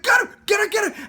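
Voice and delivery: high, loud urging yell; mock crowd egging on. Wrestling crowd urges on its favorite, yelled in excitement. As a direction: chant it like a fan at ringside.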